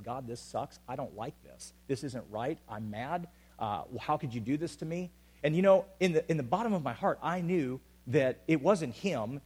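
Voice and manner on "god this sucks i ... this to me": quiet honest prayer. Retold or imitated. Preacher retelling his own honest prayer to God, hushed. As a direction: confess it softly, hurt and tired. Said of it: "I said".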